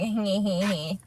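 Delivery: soft, sly little snicker; a hushed, sneaky titter; sneaky little laugh. Retold or imitated. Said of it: "You get the little sneaky little laugh".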